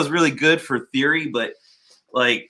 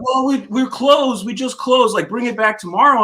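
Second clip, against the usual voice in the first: mocking shop workers; 11 semitones higher, 5 dB louder than usual. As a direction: mimic the careless staff brushing him off. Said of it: "And they're like".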